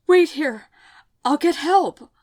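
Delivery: loud, high, panicked; frantic urgency over a dying friend; urgent plea. A narrator's reading. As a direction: call it out in a rush of fear. Frantic over wounded Isabel.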